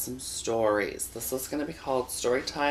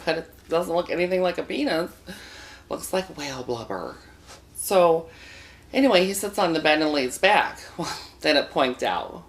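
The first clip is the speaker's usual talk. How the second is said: ordinary talk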